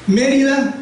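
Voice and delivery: "Mérida" pitched high, strong on the first syllable "Mé"; stress on MÉ. Shown as a demonstration. Teacher giving the example, "Mérida" stressed on its first syllable. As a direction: punch the "Mé" of "Mérida". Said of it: "la que suena más fuerte".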